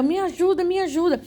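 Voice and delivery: high, urgent reenacted plea; pleading for help. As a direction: beg upward, pitched high without shouting. Reenacting plea while looking heavenward. (súplica aguda, encenada)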